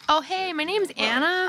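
high, loud-ish, slow fake Midwest American drawl; hammed-up Midwest American introduction; terrible Midwest accent. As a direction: put on a badly faked Midwest accent. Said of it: "a terrible Midwest accent".

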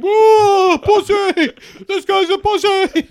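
very high, loud laughing yell; mock screaming crowd. Joining the retelling, the crowd screams at the hero. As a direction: scream the taunt while cracking up. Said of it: "they all scream".

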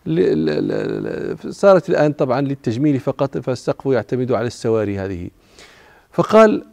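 ordinary talk

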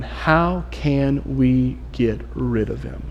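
a slow, low-volume plotting murmur; scheming hush. Retold or imitated. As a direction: hush it like a conspirator. Pharisees plotting against Jesus.

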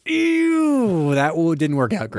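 squeaky high sailor voice; high mock voice. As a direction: pitch it way up, sheepish. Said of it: "kinda like".

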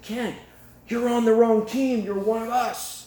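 high, drawn-out shout to a teammate; calling out urgently. Retold or imitated. Inmate warning Kent in a dream, high urgent call. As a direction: plead loudly in a high, stretched voice.

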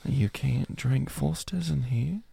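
soft, hushed Australian librarian; subdued Australian accent. Acted. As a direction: shush a patron in a quiet Aussie accent. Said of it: "a more subdued Australian".

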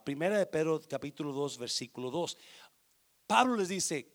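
normal talking voice

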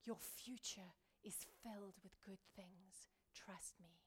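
slow, soft whisper. Retold or imitated. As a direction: whisper hope into a hopeless heart. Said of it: "whispering into their hearts".

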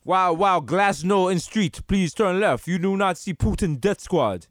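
put-on Russian-accented GPS navigator; mock Russian knockoff of a street guy; stolen-voice Russian accent. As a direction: give directions in a comic Russian accent. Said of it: "they have your accent".

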